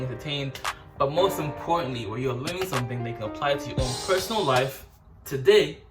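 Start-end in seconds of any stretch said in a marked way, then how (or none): 5.3-5.8 loud drawn-out today. Acted. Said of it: "today, today repeated".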